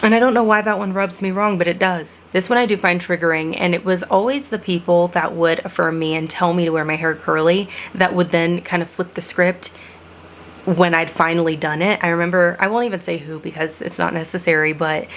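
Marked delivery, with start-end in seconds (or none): none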